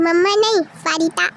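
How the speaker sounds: ordinary delivery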